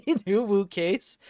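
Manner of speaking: laughing through the words in disbelief; amused, laughing it out. Real, not acted. Streamer laughing at a chat message, laughing.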